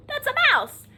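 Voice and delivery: plain reading voice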